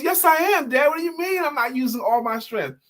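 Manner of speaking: put-on high, indignant child voice; protesting boy. Boy protesting to his father, high and indignant. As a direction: insist you're trying, like a kid. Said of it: "He goes".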